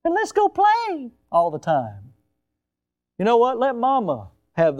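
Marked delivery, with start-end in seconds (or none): 0.0-1.1 put-on baby talk. Children remembering daddy's baby talk, high and cooing. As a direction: coo playfully at a toddler. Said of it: "All they knew was".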